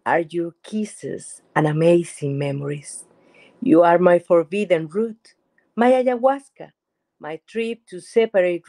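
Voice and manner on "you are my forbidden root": ordinary talk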